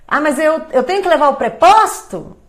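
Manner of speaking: mock a lawyer who doesn't know the job; confused lawyer on the phone; clueless caller. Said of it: "a pessoa fala assim".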